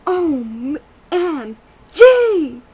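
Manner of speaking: loud, slow, drawn-out OMG; shocked outcry. Acted. Kid shocked at seeing E T, slow loud outcry. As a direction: stretch it out in disbelief.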